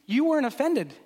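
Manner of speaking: bystanders' disbelief. Retold or imitated. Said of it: "people were looking on being like".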